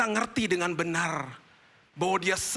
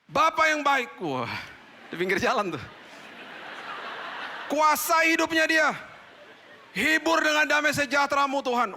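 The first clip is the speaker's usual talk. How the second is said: fervent loud prayer; 11 semitones higher, 3 dB louder than usual. Retold or imitated. Praying loudly by the roadside.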